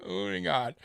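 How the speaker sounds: chuckling through the words; laughing. Real, not acted. Laughing at himself while changing the subject. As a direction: let the laugh spill into it.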